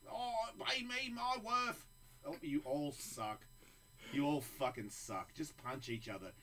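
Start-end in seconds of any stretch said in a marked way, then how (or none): 0.1-1.9 higher, slow whiny mock demand; Conor McGregor. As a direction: whine it like a diva fighter. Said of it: "McGregor talking".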